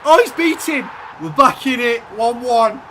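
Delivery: high, loud cheering of the goal; ecstatic celebration. Real, not acted. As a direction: let it rip for the equaliser. Equaliser goes in.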